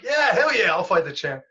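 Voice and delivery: bursting high and loud on hell yeah, then settling; eager yes. Retold or imitated. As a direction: jump at the offer, thrilled. Eager yes to a title fight.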